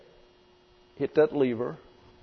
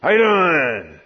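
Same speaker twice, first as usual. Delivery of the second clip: louder and booming; scary loud greeting; 3 semitones higher, 5 dB louder, slower than usual. Shown as a demonstration. Preacher shows the greeting that frightens children off, loud. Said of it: "They run".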